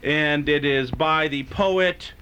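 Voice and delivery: slow grand announcement; announcer intro. Acted. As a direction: announce it like a showman.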